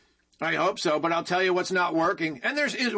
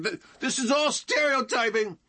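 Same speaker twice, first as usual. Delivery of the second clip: a higher, shouted mock of a leftist newscaster; 5 semitones higher than usual. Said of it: "leftoid newscaster in Minneapolis going".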